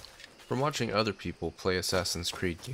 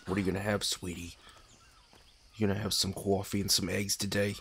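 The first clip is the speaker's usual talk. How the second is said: lightly husky truck stop waitress; a hint of a husky diner waitress. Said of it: "husky truck stop waiter, waitress voice".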